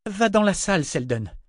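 lifted, forced brightness; falsely cheerful. Acted. Mother sends her crying boy inside, falsely cheerful. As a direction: put on cheer for a frightened child. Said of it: "d'un ton faussement joyeux".

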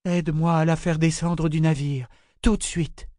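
firm, insistent demand; brooking no argument. Acted. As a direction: give an order nobody may question. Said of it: "L'intonation dans la voix de l'étrangère n'admettait pas de réplique".

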